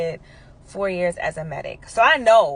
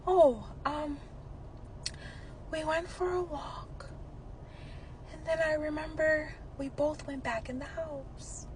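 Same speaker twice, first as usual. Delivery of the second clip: dazed recollection; 8 semitones higher, 12 dB quieter, slower than usual. Retold or imitated. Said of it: "He goes".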